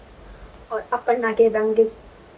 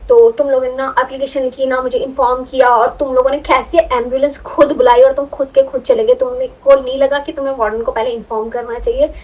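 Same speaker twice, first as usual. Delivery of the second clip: scolding yell; 3 semitones higher, 7 dB louder than usual. Retold or imitated. Warden demanding why they left without informing, loud scolding. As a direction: keep yelling the questions at them. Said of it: "उसने इतना हम पे चिल्लाया".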